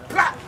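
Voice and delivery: one explosive "Plop" like a band hit; sudden vocal burst. Shown as a demonstration. Teacher showing the groove exploding back, sudden percussive hit. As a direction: burst out abruptly after the hush.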